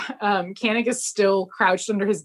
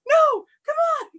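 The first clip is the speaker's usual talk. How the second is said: very high despairing cry; anguished protest; faster than usual. Retold or imitated. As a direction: wail at the song coming back. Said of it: "he's like".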